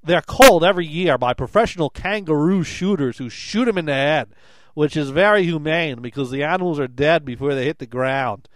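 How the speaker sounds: put-on accent that drifts toward South African and Texas; Australian accent attempt. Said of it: "I gotta read that in the accent".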